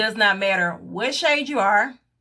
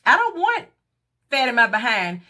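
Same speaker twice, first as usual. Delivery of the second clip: indignant refusal; 6 semitones higher than usual. Retold or imitated. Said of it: "my response was".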